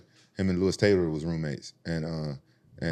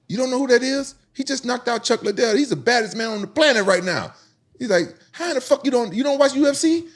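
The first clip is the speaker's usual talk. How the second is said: excited teammate; 6 dB louder than usual. Retold or imitated.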